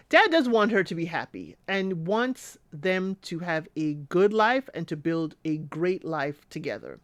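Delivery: ordinary delivery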